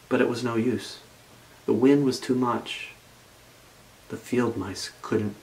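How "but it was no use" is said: usual speaking voice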